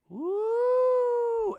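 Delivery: long, high woo; high-pitched whoop. Real, not acted. Host reacting to Janet's threat, long high whoop. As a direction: let out a drawn-out falsetto whoop.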